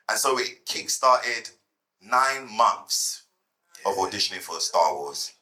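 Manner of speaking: ordinary talk, nothing marked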